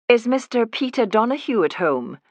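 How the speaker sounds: a touch too genteel Edinburgh voice for a Leeds courtyard; disguised nurse asks politely in a posh Edinburgh accent. Acted. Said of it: "I do a passable Edinburgh accent".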